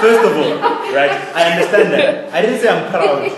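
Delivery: loud laughter running through the words; laughing hard while answering back; loud, amused laughter at a friend. Real, not acted.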